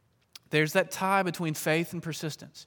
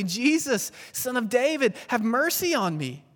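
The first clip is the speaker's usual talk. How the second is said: crying out; 5 semitones higher, 5 dB louder than usual. Acted. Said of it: "he began to cry out and say".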